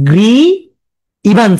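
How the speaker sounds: GRI, pause, IVANS; split pronunciation. Shown as a demonstration. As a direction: say গ্রি ইভান্স as two separate pieces. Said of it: "এ পড়তে পারো". (গ্রি ইভান্স ভেঙে ভেঙে উচ্চারণ)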